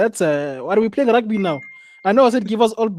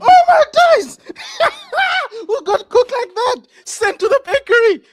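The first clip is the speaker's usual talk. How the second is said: laughing shout; 4 dB louder than usual. Real, not acted. A player gets beaten badly.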